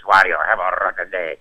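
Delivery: lightly voiced rough Wario; a hint of the greedy brute. Another studio day as Wario, lightly voiced. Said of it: "another day it's".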